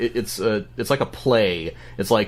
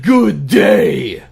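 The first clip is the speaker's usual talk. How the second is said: very loud, high indignant yell; furious mock shout; 5 semitones higher, 9 dB louder than usual. The outraged client getting the last word with the dominatrix, furious shout. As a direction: bellow it as a hammed-up parting shot. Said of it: "with a fury and says".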